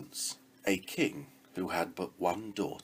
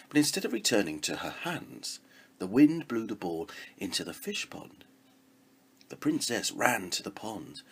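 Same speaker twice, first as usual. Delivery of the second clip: plain reading voice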